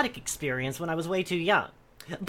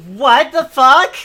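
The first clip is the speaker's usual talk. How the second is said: loud, stunned disbelief; drawn out in shock; 5 semitones higher, 12 dB louder, slower than usual. Real, not acted. Shocked reaction to confession.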